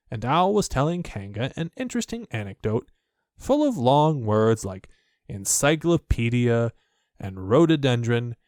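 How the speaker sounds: usual speaking voice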